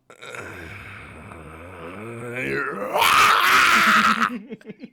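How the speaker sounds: long rising mock-climax scream; loud climax roar. Comic faking a climax for his friend, loud rising roar. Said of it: "So loud".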